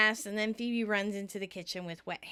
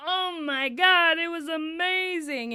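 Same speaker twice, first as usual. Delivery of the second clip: high, loud, drawn-out rave; hammed-up awe, stretching every word; mock gushing; 7 semitones higher, 6 dB louder, slower than usual. Host imagines co-host raving about the film, loudly gushing.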